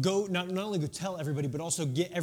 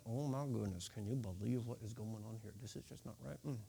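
much softer, lower, fast grumbling; muttering crowd; 10 semitones lower, 13 dB quieter, faster than usual. Retold or imitated. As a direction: gossip it under your breath to a neighbour. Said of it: "talking out of the side of their mouth".

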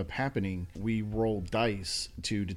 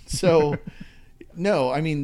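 higher and louder, laughing nervously; nervous laughter; 6 semitones higher, 7 dB louder than usual. Real, not acted. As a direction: giggle it out nervously. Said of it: "I'm laughing 'cause I'm scared".

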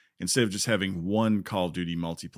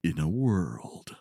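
slow, deep trailer announcer voice; movie trailer guy; 4 semitones lower, slower than usual. Shown as a demonstration. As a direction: intone it like a film trailer. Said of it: "like the, uh, movie trailer guy".

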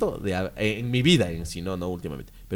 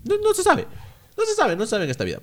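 emphatic exclaim; faster than usual. Real, not acted. Emphatic agreement.

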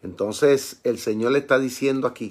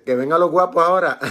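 raised, amused challenge; playful dare; 5 semitones higher, 4 dB louder than usual. Acted. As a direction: dare the tough guys with a laugh close behind. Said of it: "Dios también tiene su chispa". (reto burlón y jocoso)

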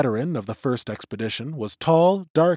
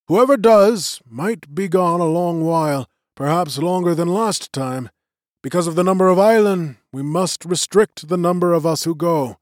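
lightly voiced elder, raised and formal; magician's voice; 6 semitones higher, 5 dB louder than usual. A narrator's reading. Old magician opening the vote to the clan, lightly voiced and raised. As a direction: address the gathering like a presiding elder.

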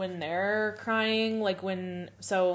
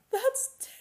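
mock tearful wail; 13 semitones higher than usual. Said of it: "she just has to go".

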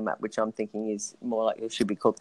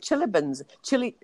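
attempting a Kiwi accent on chili bins; 8 semitones higher than usual. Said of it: "I can't even say it".

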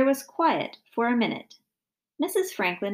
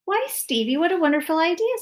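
higher, quick delighted mother; excited; 8 semitones higher, 4 dB louder than usual. A narrator's reading. Mother praising son's flower idea, excited. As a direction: gush over the idea. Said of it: "said Missus Franklin excitedly".

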